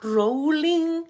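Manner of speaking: high, stretched-out creature chant; toy stegosaurus rolling. Acted. Stegosaurus rolling on his spines in play, high drawn-out chant. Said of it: "And he's going".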